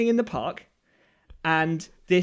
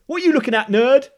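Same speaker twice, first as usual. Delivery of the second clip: louder, higher aggressive jibe; mock bully's challenge; 5 semitones higher, 9 dB louder than usual. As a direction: imitate a lad picking a fight. Voicing a bullying youth's challenge.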